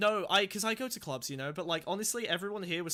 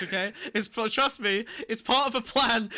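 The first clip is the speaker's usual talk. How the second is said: normal talking voice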